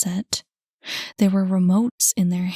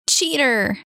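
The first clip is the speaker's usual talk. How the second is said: wailed; 4 semitones higher, 4 dB louder than usual. A narrator's reading. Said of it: "wailed Cam".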